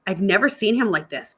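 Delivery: ordinary delivery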